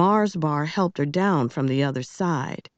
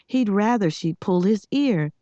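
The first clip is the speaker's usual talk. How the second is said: plain reading voice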